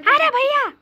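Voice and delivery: high loud call; calling brother. Acted. Little brother calling big brother for the bodyguard, high call. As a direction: shout up to your big brother to get his attention.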